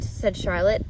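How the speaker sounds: plain reading voice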